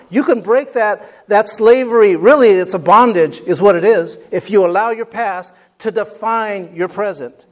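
loud, rising fired-up preaching; preaching at full force. Acted. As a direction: build it up like a closing exhortation.

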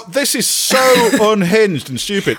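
high, slow, exasperated; exasperated outburst. Real, not acted. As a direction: let the disbelief burst out. Exasperated rant about plot.

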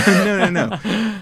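laughing through the words; high and loud with laughter. Real, not acted. Laughing at political dodge.

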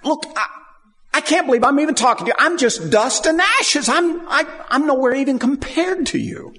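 high, fast, self-abasing plea; a servant pleading before God; humble pleading. Retold or imitated. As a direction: beg like someone who is only dust and ashes. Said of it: "Then he humbles himself".

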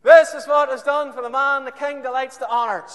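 high, loud herald cry; public proclamation. Acted. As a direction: proclaim it to the whole street. Haman proclaiming mid phone call.

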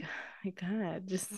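whispered, worn-out "oh my God"; exasperated whisper. Retold or imitated. A mom whispering to herself, worn out by her kid, exasperated whisper. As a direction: breathe it out under your breath, at the end of your rope.

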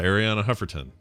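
normal talking voice

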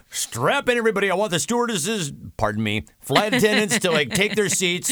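hammed-up pilot warning passengers to buckle up; urgent pilot. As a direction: announce it like a captain bracing the cabin. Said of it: "the pilot's like".

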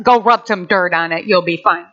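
low, unmoved grandpa. Retold or imitated. Grandpa answers bleeding grandchild, low and unbothered. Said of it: "My grandpa".

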